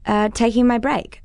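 mumbling through a mouthful; mouth full. Acted. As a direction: mumble round a bite of food. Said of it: "I mumble with my mouth full".

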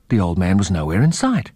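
ordinary delivery

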